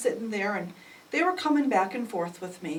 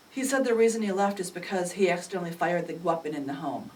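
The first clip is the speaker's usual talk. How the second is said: ordinary talk, nothing marked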